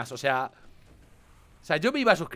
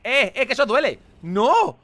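loud yelp of pain; pained yell; 6 semitones higher, 8 dB louder than usual. Real, not acted. Gamer yelling at the enemy that hits him, loud. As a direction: yell as the hit lands.

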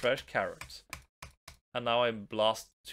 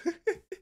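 high squealing laugh. Real, not acted. Laughs at the witch.

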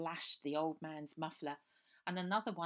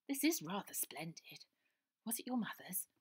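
posh voice; 5 semitones higher than usual. A narrator's reading. Posh girl admires jacket.

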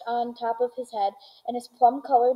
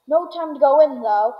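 hurried call; 4 semitones higher, 7 dB louder than usual. A narrator's reading. Wonka hurrying past the doors.

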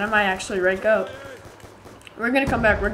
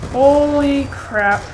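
slow, louder, higher astonishment; stunned; 4 semitones higher, 7 dB louder, much slower than usual. Real, not acted. Gamer stunned by a play, slow astonished exclaim. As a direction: stretch it out in awe. Sudden in-game moment.

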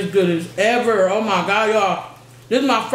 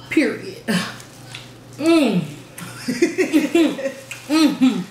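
ordinary delivery

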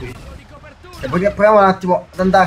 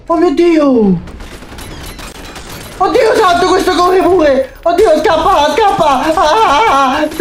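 frantic, fleeing screams; panicked screaming; 11 semitones higher, slower than usual. Real, not acted. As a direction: shriek in real panic as it chases you. Panicked by a monster chasing him.

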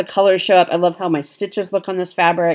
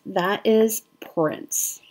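slow hushed whisper; 6 dB quieter, much slower than usual. Real, not acted. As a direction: breathe it out like a secret. Reverent aside naming the design.

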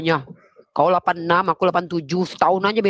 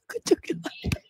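high squeaky voice mocking the words; childish high-pitched mockery; squeaky mocking mimic. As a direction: parrot the insult in a high squeak.

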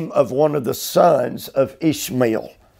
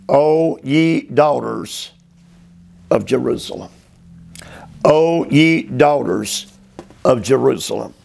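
solemn declaiming. Acted. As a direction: proclaim it like an ancient address. Declaims address twice, slowly.

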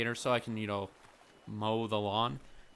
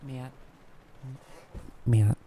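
mom voice, a bit louder, in another timbre; imitating mom; 3 semitones lower, 3 dB louder than usual. Mother asking son to mow the lawn, mock mom voice. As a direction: put on his mother's voice asking a favour. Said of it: "my mom's like".

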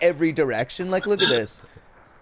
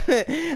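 high laugh; 12 semitones higher than usual. Real, not acted. Laughing after game loss.

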